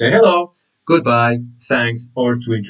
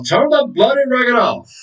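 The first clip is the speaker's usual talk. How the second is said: higher-pitched mock exasperation; fed-up demand, pitched up; mild annoyance; 8 semitones higher than usual. Shown as a demonstration. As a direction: act out an irritated order. Recaps the acted slang demand.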